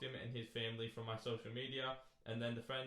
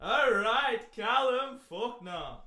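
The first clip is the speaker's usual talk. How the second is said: loud, slow, drawn-out name call with laughter; booming call-out; 11 semitones higher, 13 dB louder, much slower than usual. Real, not acted. Teasing his cohost before the long listener letter, a loud drawn-out call. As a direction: bellow the name across the room like a ring announcer.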